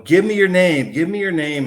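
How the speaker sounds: loud, high commanding demand; an exorcist's forceful command. Retold or imitated. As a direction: order it to give its name. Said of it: "starts commanding this thing".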